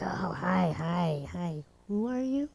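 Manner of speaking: soft, fake-puzzled hi; playing dumb. Pretending not to recognize the girl, quiet and blank. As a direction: pretend not to know her, softly. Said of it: "I was dead serious".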